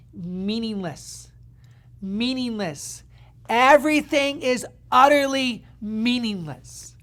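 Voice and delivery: slow, drawn-out, weighty verse reading; solemn pronouncement, building; dramatic scripture reading. Acted. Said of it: "And it says this".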